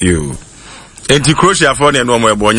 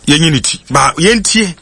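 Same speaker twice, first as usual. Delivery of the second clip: ordinary talk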